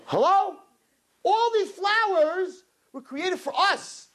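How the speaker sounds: high, slow, insistent call; emphatic proclamation. Retold or imitated. Telling the ladies the flowers are for them, high insistent call. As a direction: call it out high, stretching the words. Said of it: "And I'm serious".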